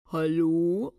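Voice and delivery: loud, drawn-out male phone voice; disguised deep male voice; deep man's voice. A narrator's reading. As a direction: put on a booming man's hello. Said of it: "sagte eine tiefe Männerstimme".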